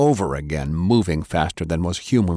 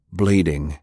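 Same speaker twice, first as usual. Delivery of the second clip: usual speaking voice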